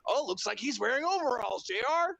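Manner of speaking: high teasing announcer voice; mocking Jericho. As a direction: imitate him needling J R. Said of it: "Jericho goes in".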